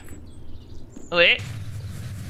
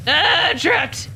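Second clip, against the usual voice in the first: high, slow melodramatic cry of being trapped; mock panic; 9 semitones higher, much slower than usual. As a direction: ham it up like a trapped victim. Pretend panic in game.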